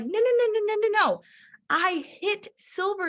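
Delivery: insist, bursting; correcting husband, thrilled; excited insistence. Retold or imitated.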